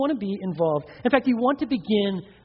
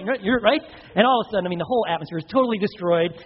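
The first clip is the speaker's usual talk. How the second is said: usual speaking voice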